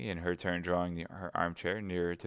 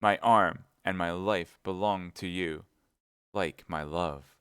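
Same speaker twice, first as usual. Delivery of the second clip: slow, weighty vow; with emphasis; 4 dB louder, slower than usual. Acted. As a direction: press each word like an oath. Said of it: "said he with emphasis".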